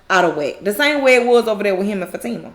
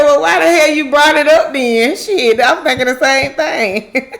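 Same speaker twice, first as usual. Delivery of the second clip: louder, higher amused comeback; retold exasperation; 4 semitones higher, 5 dB louder than usual. Maurice answering Sabrina about the money, mock exasperation. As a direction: throw it back in mock disbelief.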